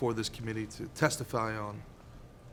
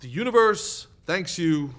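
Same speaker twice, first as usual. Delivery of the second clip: louder, slow, pompously grand; overblown booming thanks; 7 semitones higher, 7 dB louder, slower than usual. Acted. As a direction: top the others with mock grandeur. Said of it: "Would you stop?".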